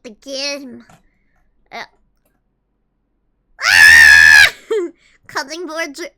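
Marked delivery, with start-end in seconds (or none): 3.6-4.5 loud, deep fake scream of alarm; mock scream. Thrown into jail, loud mock scream. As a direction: burst out with a hammed-up scream.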